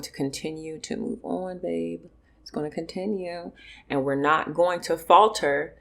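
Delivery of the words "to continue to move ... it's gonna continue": much softer, gentle reassurance; almost hushed, comforting. Real, not acted. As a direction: ease them through it quietly. Comforting the audience softly.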